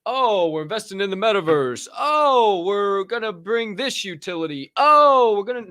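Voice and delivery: high, mocking voice of a hyped NFT project; mock hype investor. Host mocking NFT projects to viewers, high mocking voice. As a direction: sneer at empty metaverse promises. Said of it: "so nothing like".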